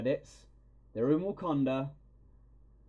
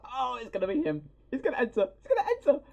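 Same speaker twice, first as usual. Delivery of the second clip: higher and louder to a squeal; excited squeal building; 14 semitones higher, 7 dB louder than usual. Retold or imitated. Fan grabbing girlfriend's hand, squealing higher and higher. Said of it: "I was like".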